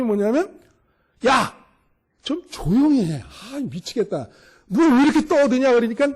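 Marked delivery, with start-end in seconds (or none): 1.3-5.7 exasperated mock telling-off, pitch jumping up; acted annoyed scolding. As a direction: imitate fed-up followers hushing someone. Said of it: "제자들이 너무 시끄럽잖아요".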